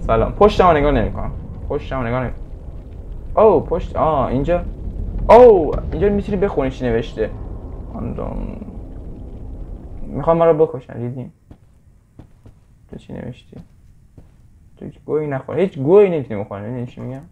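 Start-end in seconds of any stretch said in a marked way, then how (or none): none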